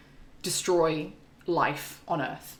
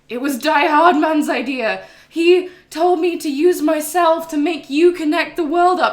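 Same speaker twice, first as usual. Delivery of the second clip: higher, louder put-on female voice; mock Fragile voice; 8 semitones higher, 8 dB louder than usual. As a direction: play her defensive and shrill-loud. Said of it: "And she's like".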